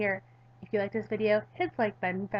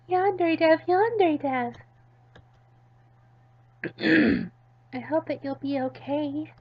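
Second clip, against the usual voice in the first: put-on high, anxious girl whispering hope; worried girl voice; 7 semitones higher, 6 dB louder, slower than usual. Midori at his bedside, high hopeful girl voice.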